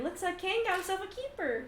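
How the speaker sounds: high, lively ribbing; friendly teasing. Acted. Squadmate approves of the general's wife, high playful teasing.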